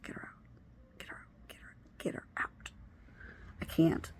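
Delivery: desperate whispered plea; urgent whisper. Retold or imitated. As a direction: whisper it so she can't hear.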